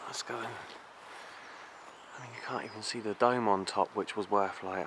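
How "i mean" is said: ordinary talk, nothing marked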